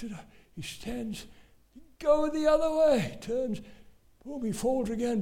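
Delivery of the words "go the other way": high, loud urgent call; impassioned high shout; crowd screaming directions. Retold or imitated. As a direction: yell it across a stadium. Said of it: "Everyone screaming".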